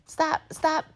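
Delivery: high-pitched, exasperated stop; exasperated scolding. Real, not acted. Viewer telling Fatima to quit, exasperated.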